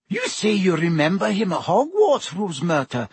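ordinary delivery, nothing marked

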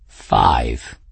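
low, smug and unhurried; smug cat. Acted. Cat boasting to Bridget, low and smug. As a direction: savour the boast like a proud cat. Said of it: "he said smugly".

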